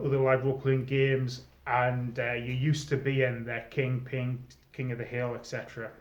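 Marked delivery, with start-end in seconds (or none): none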